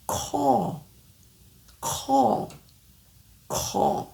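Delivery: "Call" open vowel, no L; "Call" drilled slowly, fading to breath; repeated call model. Shown as a demonstration. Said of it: "la bonne manière de le dire".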